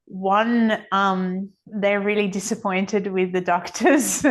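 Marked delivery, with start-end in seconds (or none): none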